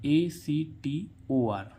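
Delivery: letters spelled out slowly one by one; slow letter-by-letter spelling. Shown as a demonstration. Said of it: "actor".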